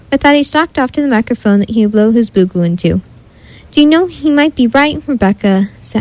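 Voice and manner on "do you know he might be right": plain reading voice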